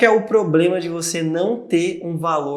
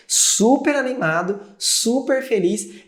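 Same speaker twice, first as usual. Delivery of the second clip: high, slow, gushing super-excited voice; mock hype; 7 semitones higher, slower than usual. Mocking people who hype every novelty, exaggerated excitement. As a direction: ham up the giddy enthusiasm.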